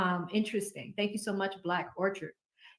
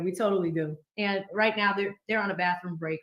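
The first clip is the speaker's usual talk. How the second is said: ordinary delivery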